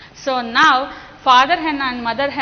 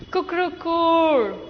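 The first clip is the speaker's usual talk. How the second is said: cuckoo like a little chick; chick call; 3 semitones higher than usual. Shown as a demonstration. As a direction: model the chick sound. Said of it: "Repeat after me".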